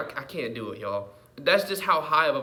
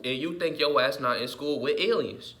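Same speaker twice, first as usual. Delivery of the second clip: normal talking voice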